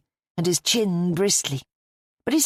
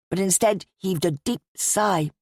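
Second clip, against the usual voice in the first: plain reading voice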